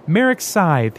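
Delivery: lightly hammed-up fanfare; grand proclamation. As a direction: present him like a hero. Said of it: "he proclaimed grandly".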